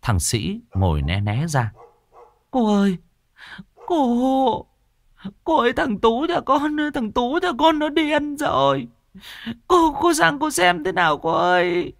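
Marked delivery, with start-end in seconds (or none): 2.5-11.9 high, whining plea; wailing woman. A narrator's reading. Desperate neighbour wailing at Cô An's feet, high whining plea. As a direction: wail and beg, much higher. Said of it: "ôm lấy chân cô mà khóc lóc".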